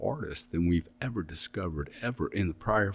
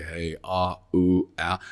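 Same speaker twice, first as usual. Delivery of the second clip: mock victim whine; 3 dB louder, slower than usual. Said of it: "be a victim and say".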